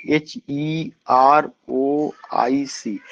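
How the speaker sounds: slow letter-by-letter spelling; spelled out. Shown as a demonstration. Teacher spelling HEROIC for students, slowly letter by letter.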